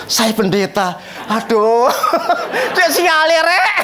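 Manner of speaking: ordinary delivery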